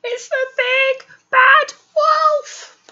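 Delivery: high, slow frightened squeal; scared pig voice. Acted. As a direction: cry it out in fright. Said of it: "said Horace".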